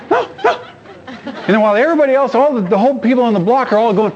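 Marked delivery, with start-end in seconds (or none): 0.1-0.5 mock denial. Pranksters deny.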